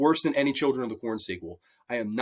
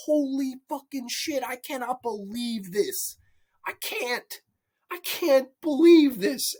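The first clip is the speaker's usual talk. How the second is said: acted disbelief; 7 semitones higher, slower than usual. Acts out own disbelieving reaction.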